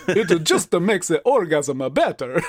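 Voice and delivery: hammed-up fake Italian Dracula; cartoonish mock Italian; bad Italian accent. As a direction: put on a terrible Italian accent for a vampire. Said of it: "like, a really bad Italian accent".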